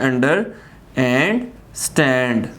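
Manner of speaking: "under", "and", "stand" each set apart by a pause; measured teacherly split of under and stand; two separate words. Shown as a demonstration. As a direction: divide under from stand with clear gaps. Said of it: "दो अलग words हैं".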